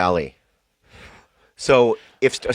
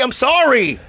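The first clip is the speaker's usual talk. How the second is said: demanded apology; 14 semitones higher, 4 dB louder than usual. Shown as a demonstration. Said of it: "Just say".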